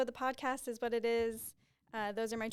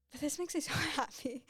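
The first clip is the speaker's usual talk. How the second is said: higher, laughing through welling tears; happy and tearful; 4 semitones higher than usual. Real, not acted. Podcaster moved by reviewing their old plan, happily tearful. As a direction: overcome with happiness, trying not to cry. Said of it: "I'm not gonna cry".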